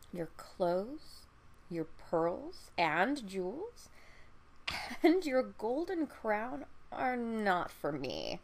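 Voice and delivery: drawn-out, deliberate frog voice; frog refusing. Acted. Frog turning down the princess's treasures, slow and deliberate.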